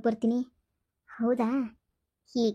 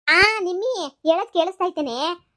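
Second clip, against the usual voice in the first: higher, louder and slow into the phone; 5 semitones higher, 5 dB louder, slower than usual. Acted. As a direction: call out slowly so it carries. Loud phone call to overhear. (ಫೋನಿನಲ್ಲಿ ನಿಧಾನವಾಗಿ ಜೋರಾಗಿ)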